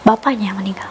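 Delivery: whispered, voiceless, as if it's hard to say; hushed. Real, not acted. Telling her friend the father died, in a whisper. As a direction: breathe the news out with no voice.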